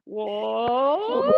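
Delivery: rising whoop; demo whoop. Shown as a demonstration. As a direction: whoop from the belly. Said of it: "Go higher and higher".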